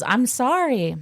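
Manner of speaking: retold pleading apology. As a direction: mimic a friend pleading, pitch lifted. Retold friend's repeated apology.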